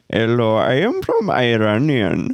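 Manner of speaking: put-on hammy Indian accent greeting. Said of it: "I'd just do the Indian accent".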